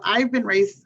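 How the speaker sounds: normal talking voice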